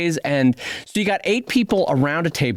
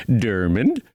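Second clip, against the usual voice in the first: mock Swedish chef; 6 semitones lower, much slower than usual. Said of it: "the whole table had to say".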